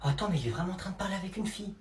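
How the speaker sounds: soft, low, fast whisper-like murmur; astonished aside. Acted. Eavesdropper convinced there's a girl, hushed astonished aside. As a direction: realise it under your breath.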